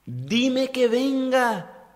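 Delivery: high, slow call across the water; Peter calling out. Retold or imitated. Peter cries out to Jesus on the sea, high slow call. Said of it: "Y grita".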